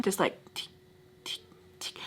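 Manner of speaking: hushed, breathy tick tick; whispered ticking. Retold or imitated. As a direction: whisper the ticks like a clock. Ticking clock imitation, whispered.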